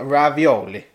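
put-on ravioli rolled out in an Italian accent; mock Italian ravioli. Mocking a TV chef's Italian accent. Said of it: "You don't put on an accent".

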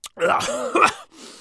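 real splutter on a mouthful of water; choking cough. Real, not acted. Botched water-cup demo, real choking cough. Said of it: "I almost choked".

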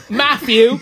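loud, high mock-Cockney call; hard Cockney. Joking she'd question Matt this way, hard Cockney. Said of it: "like, a hard Cockney accent for this".

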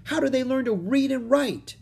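put-on a bright, high girl's protest; Annie's voice. A narrator's reading. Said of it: "said Annie".

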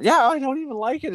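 high falsetto imitation; mock dismissive girl. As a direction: mimic a girl brushing it off in a squeaky voice. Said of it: "Fucking find out".

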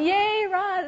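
high, loud drawn-out cheer; hammed-up "yay" of support; mock cheering. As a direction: whoop it up for the baby bump. Cheering daughter's pregnancy photos.

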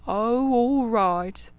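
very slow, easygoing giving in; good-natured. A narrator's reading. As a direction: kindly agree to rest. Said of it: "said the good-natured Rat".

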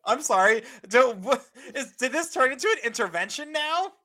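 high, loud, through laughter; laughing disbelief. Real, not acted. Co-host asking if this is an intervention, laughing in disbelief. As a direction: protest while cracking up. Cracking up at friend's pushy advice.

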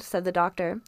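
ordinary delivery, nothing marked

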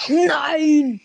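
sharp frustrated cry of no; loud yell. Real, not acted. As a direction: yell it out in dismay.

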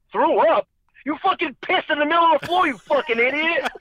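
high, fast, incredulous cousin voice; cousin's outburst. Retold or imitated. Cousin yelling at him for pissing on the floor, high incredulous voice. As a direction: blurt it out in disbelief, pitched well up. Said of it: "He's like".

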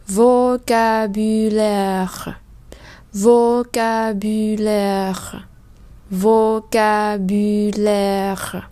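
vocabulaire very slow, each syllable drawn out; slow syllable drill. Shown as a demonstration. Teacher modelling a long French word, very slow. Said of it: "đối với các từ dài".

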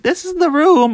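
high-pitched mock presenter voice; put-on high voice, mocking the listing; mimicked landlords. Mimics landlords showing a bad room.

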